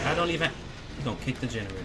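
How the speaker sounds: ordinary talk, nothing marked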